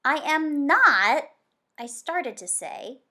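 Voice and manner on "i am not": loud, slow, insistent denial; indignant protest. Acted. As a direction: push back hard on every word. Sam protests loudly.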